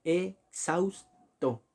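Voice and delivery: "exhausto" very slow as e... xaus... to; syllable by syllable. Shown as a demonstration. Teacher dividing the word for students, "exhausto" as e... xaus... to. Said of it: "Tenemos ese tipo de separación".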